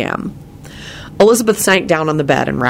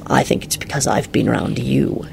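understated dry reply; wry; 3 semitones lower, 4 dB quieter than usual. A narrator's reading. Man answering woman's half smile, wry. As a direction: tease back drily. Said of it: "he replied wryly".

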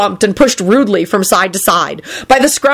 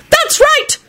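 very high, rousing cheer; shouted support; slower than usual. A narrator's reading. Bystander wizard egging Neville on, shouted. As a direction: cheer him on from the crowd. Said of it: "shouted one of the watching wizards".

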